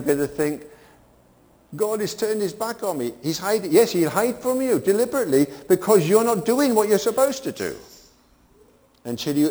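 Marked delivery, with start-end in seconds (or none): 1.7-3.7 imagined complaint. Retold or imitated. 3.7-7.8 loud, high preaching push; preaching at full force. Acted. Preacher drives the point home.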